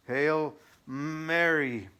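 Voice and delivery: very slow, high, loud furious 'Hail Mary'; burst of anger. Acted. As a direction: wrench it out in rage. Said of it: "In a burst of anger".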